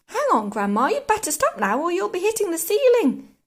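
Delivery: put-on loud, urgent boy; shouted warning. A narrator's reading. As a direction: call out a worried warning. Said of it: "George gave a jump and shouted".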